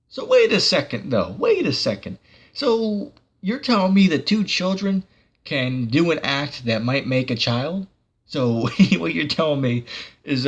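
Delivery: high-pitched mock disbelief; sarcastic. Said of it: "I'm just being sarcastic".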